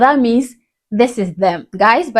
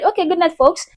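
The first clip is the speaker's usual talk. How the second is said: higher, breezy imitation of a host wrapping up; mock sign-off; 7 semitones higher than usual. Host jabbing at his guest, mock sign-off. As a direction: tease her like you're ending the show. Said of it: "to end the show when he said".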